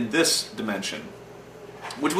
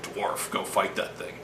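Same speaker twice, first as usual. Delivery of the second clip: whispered mock order; 4 semitones lower, 8 dB quieter, slower than usual. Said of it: "that guy who is always like".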